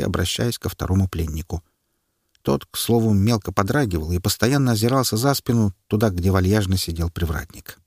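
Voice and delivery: ordinary delivery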